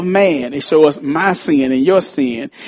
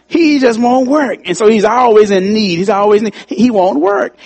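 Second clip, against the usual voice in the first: exasperated; 6 semitones higher than usual. Real, not acted. Exasperated about the brother.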